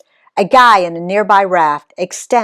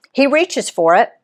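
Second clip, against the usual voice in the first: usual speaking voice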